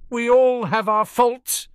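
high, slow defensive retort. Acted. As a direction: shoot back, a little hurt. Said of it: "Harry said defensively".

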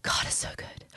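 imitating a weeping man's whisper. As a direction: whisper it, overcome with grief. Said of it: "But as he was weeping".

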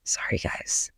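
breathy whispered sorry; whispered apology. Real, not acted. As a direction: whisper it to listeners. Apologizing during loud banging.

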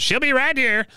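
high-pitched call back outside; Cotton. Retold or imitated. Recapper as Cotton answering the waiting driver, high-pitched holler. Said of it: "Cotton goes out and goes".

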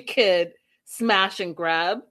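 mocking sarcastic emphasis; slow, stressed mockery. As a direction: drag out smash and grab slowly. Mocking his accusation.

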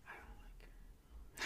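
slow, whispered; whispered confession. Retold or imitated. About a coworker who liked him, a slow whisper. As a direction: hush it like a secret.

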